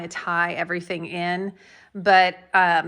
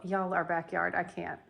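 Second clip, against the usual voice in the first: ordinary talk, nothing marked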